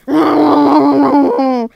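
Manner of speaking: loud frightened cry; frightened scream. Acted. Character screams at a monster.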